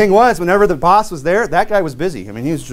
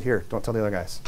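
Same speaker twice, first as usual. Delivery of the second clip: hushed aside; 6 semitones lower, 11 dB quieter, faster than usual. Retold or imitated. Boss to the speaker, handing over a bonus in a hushed voice. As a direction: boss muttering low and secretive.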